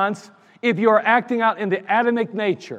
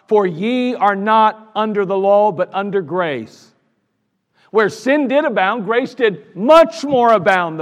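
slow, louder preached verse; preaching scripture; 5 dB louder than usual. Acted. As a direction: proclaim the verse with weight.

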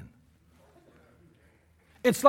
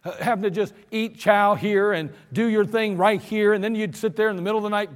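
usual speaking voice

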